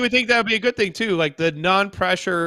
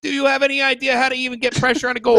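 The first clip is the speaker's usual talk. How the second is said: raised, incredulous reporter jab; mock grilling question; 6 semitones higher than usual. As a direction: badger the coach like a snarky reporter.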